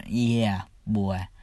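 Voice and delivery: usual speaking voice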